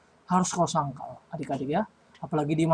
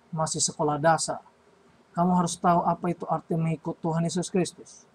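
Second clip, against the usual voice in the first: ordinary talk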